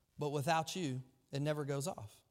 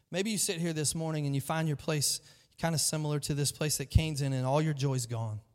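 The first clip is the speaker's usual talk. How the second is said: usual speaking voice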